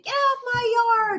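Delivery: put-on grumpy old man yelling, high; shouting at trespasser. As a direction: yell it like a cranky old man. Grumpy old men acted.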